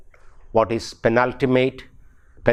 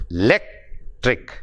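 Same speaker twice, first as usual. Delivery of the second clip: "lectric" split in two, strong "lec", then a pause before "tric"; stress on "lec"; 5 semitones lower than usual. Shown as a demonstration. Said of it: "the stress is on lectric".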